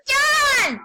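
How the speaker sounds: sudden high shout of a name; retold shout. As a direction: yell the name out, high and loud. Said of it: "he was like".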